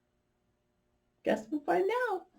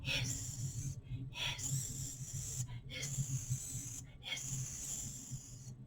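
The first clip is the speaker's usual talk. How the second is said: soft breathless hissing snake; voiceless snake hisses; 7 semitones lower, 19 dB quieter, much slower than usual. Shown as a demonstration. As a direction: hiss like a snake. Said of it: "Let me do that four times".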